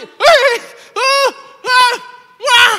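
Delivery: very high loud hails; loud calling shouts. Retold or imitated. Mute man spots them and shouts, very loud high calls. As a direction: call out to find them.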